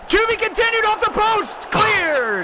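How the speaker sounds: high, loud post-hit call; excited commentary. Acted. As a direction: shout it off the post, then cleared. Shot hits post.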